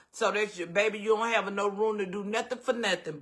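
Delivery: ordinary delivery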